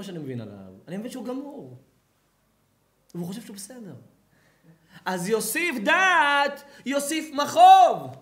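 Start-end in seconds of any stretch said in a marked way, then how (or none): 5.3-8.2 very loud, high and slow; booming verse. Acted. Rabbi thundering Kohelet's verse at his listeners, slow.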